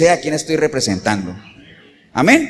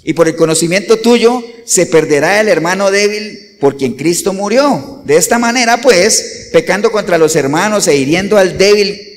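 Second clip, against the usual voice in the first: raised pulpit reading voice; solemn, raised Bible reading; preaching scripture reading; 3 semitones higher, 4 dB louder than usual. Acted. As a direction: read the epistle out to the church. Reads scripture aloud.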